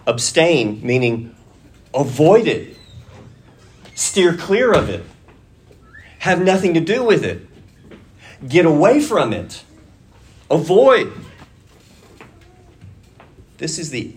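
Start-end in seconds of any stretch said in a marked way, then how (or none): 1.9-11.1 forceful preaching. Acted. Preacher drives home abstain.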